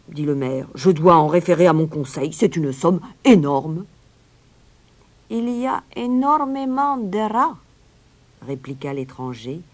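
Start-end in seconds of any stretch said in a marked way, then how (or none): 0.8-3.9 loud official mayor's voice; blustering mayor. A narrator's reading. Mayor balks at the sum, loudly blustering. Mayor pleads the huge cost. 5.3-7.6 high, amused stranger's voice; reply high with a small smile; slightly smiling stranger. A narrator's reading. Stranger counters the mayor's objection, with a little smile. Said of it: "avec un petit sourire".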